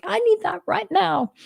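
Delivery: high-pitched, needy exclamation; urgent plea. Retold or imitated. Channeler voicing her own gratitude to the spirits, high needy plea. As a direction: exclaim it far up high, half-joking. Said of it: "I'm like".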